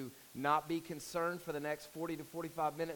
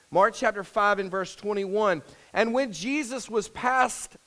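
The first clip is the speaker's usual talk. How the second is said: raised, louder solemn Bible reading; scripture reading; 6 semitones higher, 12 dB louder than usual. Acted. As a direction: read the King James text out to the church. Reads scripture aloud.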